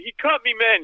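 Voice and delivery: high mock scream of the slashed man; imitated screaming. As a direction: shriek it again like the bleeding guy. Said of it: "he's screaming".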